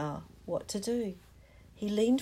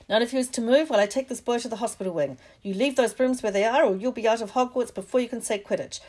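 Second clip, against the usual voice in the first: fast, raised and strict; stern warning; 3 semitones higher, 7 dB louder, faster than usual. Acted. Madam Hooch warns the class before leaving, fast and raised. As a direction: lay down the law, quickly.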